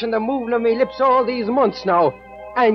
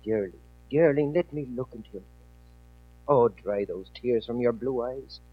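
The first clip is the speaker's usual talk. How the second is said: lower, softer, tender and soothing; tender comfort; 4 semitones lower, 4 dB quieter than usual. Acted. Jamie soothes his weeping sweetheart, soft and low. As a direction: coax her gently as she weeps.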